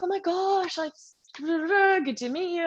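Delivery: high gushing fan voice. Retold or imitated. Woman recognizing her from social media, gushing high. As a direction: gush like a starstruck fan.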